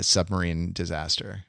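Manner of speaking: usual speaking voice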